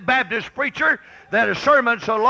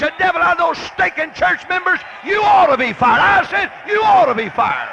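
emphatic pulpit shout; preacher's shout; 8 semitones higher, 4 dB louder than usual. Real, not acted. Preacher telling them they ought to be fired, shouting. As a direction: shout it out as a rebuke.

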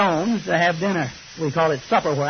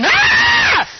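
loud, high furious yelling at his wife; screaming at his wife over spilled gravy, furious; angry screaming; 5 semitones higher than usual. Retold or imitated. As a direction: rage at her like an old grouch. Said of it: "he goes to screaming as loud as he can scream".

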